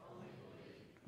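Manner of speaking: slow whispered words. Shown as a demonstration. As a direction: whisper it slowly. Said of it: "Say it again".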